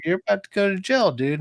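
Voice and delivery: high, fast friend's warning; retold warning. Friend warning him about jail, high and fast. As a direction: rush the bad news like a jittery buddy.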